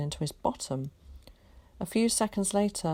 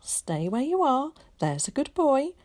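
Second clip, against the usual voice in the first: put-on higher, lilting nurse talking down to a child; sing-song; 9 semitones higher than usual. A narrator's reading. Nurse sing-songing to the boy trying to get out of bed, higher. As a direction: coax him back like a toddler, pitched up high. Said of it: "sing-songed the fair-haired nurse".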